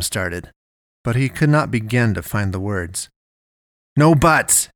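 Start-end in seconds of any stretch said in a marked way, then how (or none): 4.0-4.7 firm, louder dad's reprimand; growled. A narrator's reading. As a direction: cut the child off, louder and pitched up. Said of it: "his dad growled".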